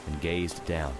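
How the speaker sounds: ordinary delivery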